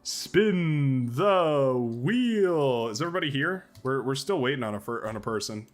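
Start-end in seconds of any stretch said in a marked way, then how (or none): 0.0-2.9 game show host. Pretend. Announces game show bit.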